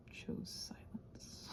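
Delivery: slow, breathy whisper trailing off; barely voiced, slow whisper. Real, not acted. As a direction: let it fade into a hushed whisper. Trails off into silence.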